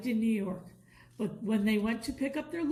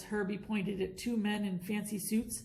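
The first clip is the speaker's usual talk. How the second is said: ordinary delivery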